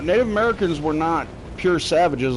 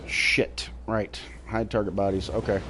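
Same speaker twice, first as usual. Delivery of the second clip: soft muttered reminder; muttering to self; 5 semitones lower, 6 dB quieter than usual. Real, not acted. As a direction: mutter it under your breath.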